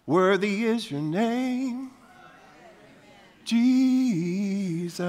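high, slow, reverent praise; worship prayer. Shown as a demonstration. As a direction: lift it up slowly as worship. Sung or prayed worship line.

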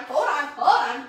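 ordinary delivery

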